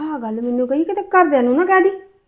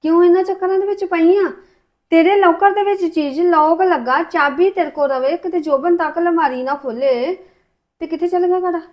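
exasperated scolding; 5 semitones higher, 5 dB louder than usual. Acted. Husband scolds wife about bracelet.

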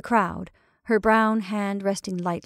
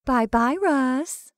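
light, lilting call; 5 semitones higher, much slower than usual. A narrator's reading. Betty to the partner she is leaving, calling out. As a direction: call a breezy goodbye. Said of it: "she called to her partner".

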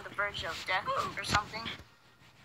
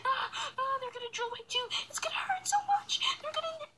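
scared whimpering; 3 dB quieter than usual. Retold or imitated. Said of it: "and I was all like".

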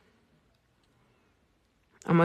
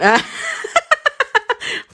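very high squealing laugh. Real, not acted. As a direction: crack up at her own mistake. Forgot the description.

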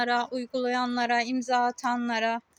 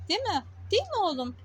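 cooing to a cat; 6 semitones higher, faster than usual. Real, not acted. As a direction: coo "Değil mi oğlum" up high. Talking sweetly to the cat. (kediye yüksek sesle sevgiyle soru)

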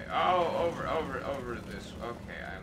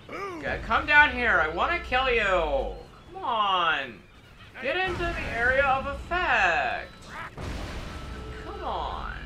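excited taunting; 9 semitones higher, 4 dB louder than usual. Real, not acted. Taunting enemies during a fight.

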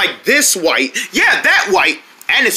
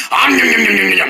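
rapid nom-nom munching noise; pretend gobbling. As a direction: gobble the meat noisily. Pretend dinosaur gobbles meat.

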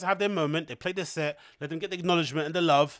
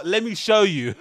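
mock mansplaining; 4 semitones higher, 6 dB louder than usual. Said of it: "Imagine if a man said that to her".

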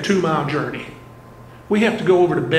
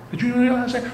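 normal talking voice

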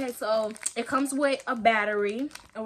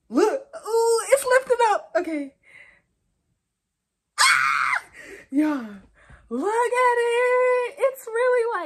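high, loud squeals of delight; squealing with glee; excited squeal; 12 semitones higher, 9 dB louder, slower than usual. Real, not acted. Peeling the screen film, squealing with delight.

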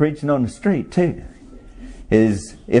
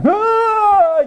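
high mock wail; one long, high-pitched wail in imitation; imitated child's cry; 4 dB louder than usual. Preacher mimicking children crying for the congregation, high wail. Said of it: "Sometimes they cry".

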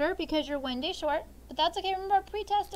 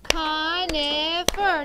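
louder, very slow CON-i-fer in beats; slow syllable count; 4 dB louder, much slower than usual. Shown as a demonstration. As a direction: stretch Conifer into three claps. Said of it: "Do it again".

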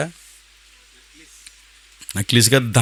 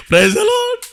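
"Praise the lord" in a much higher, playful voice, stretching "lord"; jokey high greeting. Shown as a demonstration. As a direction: tease the aunty with a sing-song "praise the lord". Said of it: "సరదాగా అన్నాను". (సరదాగా, చాలా ఎత్తైన స్వరంలో "praise the lord")

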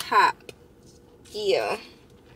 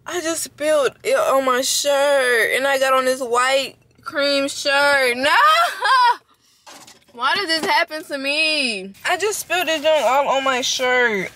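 higher, louder, slow distressed lament; dismayed wail; 5 semitones higher, 4 dB louder, slower than usual. Real, not acted. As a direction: whine about the ruined shirt. Dismay after spilling drink.

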